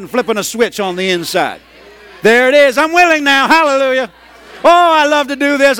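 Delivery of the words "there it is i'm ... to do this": high, loud burst of willingness; exultant declaration. Acted. Preacher shows the switch flipped to willing, exultant declaration. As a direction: proclaim it loudly from the heart. Acts out becoming willing, rejoicing.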